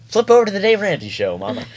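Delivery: playful call-out. Real, not acted. Teasing Mama to switch shows.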